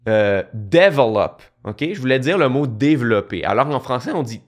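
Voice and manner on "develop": "develop" slow, stressed on the first syllable; wrong stress on develop. Shown as a demonstration. Creator showing his old mistake, first-syllable stress on "develop". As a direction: say "develop" slowly as DE-velop. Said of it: "Quand je disais".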